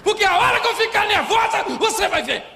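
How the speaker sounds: high, loud, hammed-up screaming; imitated yelling wife. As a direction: shout in outraged denial as the wife. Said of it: "como é que a senhora fala".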